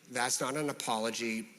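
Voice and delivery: softened, composed correction; calm. Shown as a demonstration. Answering someone's non-apology, very calm. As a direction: say it very calmly and quietly. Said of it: "just calmly say".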